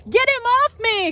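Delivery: high, loud shriek; shrieking girl. Acted. Katie yelling to get the bird off her, shrieking. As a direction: scream it with a bird in your hair. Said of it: "clambers Katie".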